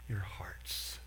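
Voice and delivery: soft whisper, almost no voice. Retold or imitated. Abraham to the angels, a soft whisper. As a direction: breathe the words out tenderly. Quiet tender quote end.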